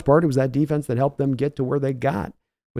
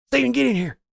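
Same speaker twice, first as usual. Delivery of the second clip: Jerry Jones yelling; 10 semitones higher than usual. Retold or imitated. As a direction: yell for your son like a furious boss. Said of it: "your Jerry Jones voice yelling at Steven".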